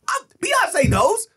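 high slow shout; slow, loud and emphatic. Real, not acted. Emphatic outburst.

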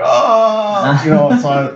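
high, drawn-out mock "oh"; flustered stalling. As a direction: imitate someone lost for words. Acts out tongue-tied buddy's reply.

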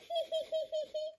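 short high giggle. A narrator's reading. Susie giggling at baby Peppa's picture, high. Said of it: "Susie giggled".

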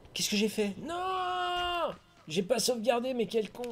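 high, stunned cry of disbelief; shocked dismay. Real, not acted. Gamer realizing he lost his progress, stunned high cry. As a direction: gasp it out as the loss sinks in. Realizes he never saved the game.

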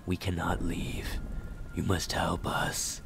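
hushed, mournful chant-like delivery; mournful spirit voice. A narrator's reading. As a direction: intone it quietly like a sorrowing ghost. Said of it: "a mournful chant".

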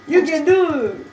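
high, loud, slow mock cheer; reading teacher impression. Player copies friend's teacher imitation, loud and laughing. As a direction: badly mimic a gushing teacher through laughter. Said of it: "Everyone does it bad except me".

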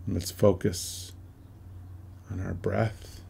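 slow meditation voice with long pauses; measured, spacious meditation leading in short phrases; guided meditation. Acted. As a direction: guide listeners through each breath, unhurried, letting phrases settle.

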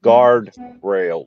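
Southern hillbilly accent. Real, not acted. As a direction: hillbilly drawl on guard rail. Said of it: "That's that damn hillbilly accent I got".

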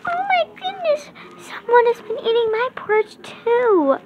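high, soft mama bear voice; a gentle, high little mother bear. Acted. Child telling Goldilocks, mama bear gasps, high and soft. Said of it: "and said".

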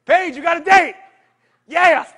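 loud, high whoop of delight; cheering a friend's good news; excited cheer. Real, not acted. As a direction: shout it out, thrilled for her. Coach cheers on Jason's date news.

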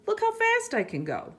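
high, loud, quick showing off; excited boast. A narrator's reading. Frog shows off its swimming.

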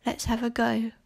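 ordinary talk

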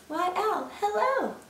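high sing-out greeting; cheery mouse. Acted. Mouse greets owl beside the Gruffalo, cheery. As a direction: greet in a high, innocent lilt.

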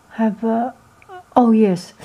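ordinary talk

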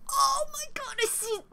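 very high-pitched fan squeal; excited squeal. Real, not acted. Fan spotting idols on screen, excited squeal. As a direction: squeal at what you spot.

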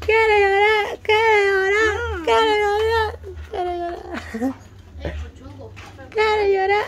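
high, whiny fake sobbing voice; mock crying. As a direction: whimper like a crybaby. Mock crying after burnt mouth.

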